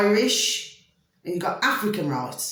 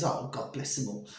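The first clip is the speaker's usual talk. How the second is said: soft, fond murmur, dropped low; tender aside; 9 semitones lower, 11 dB quieter than usual. Real, not acted. Speaker fondly blessing the foxes she feeds, softly. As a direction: coo it fondly under your breath.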